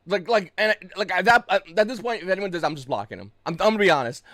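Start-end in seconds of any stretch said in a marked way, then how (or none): none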